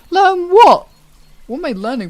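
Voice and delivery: squeaky high incredulous question; high loud what; mock outrage. Real, not acted. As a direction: shriek it in disbelief.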